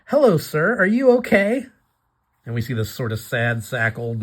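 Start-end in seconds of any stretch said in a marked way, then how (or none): 0.1-1.7 put-on much higher, sweet concerned girl; Batsy voice. Batsy kindly asks the sad old man, high and sweet. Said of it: "She says".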